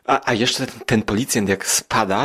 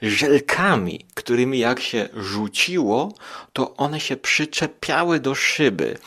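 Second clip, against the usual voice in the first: ordinary delivery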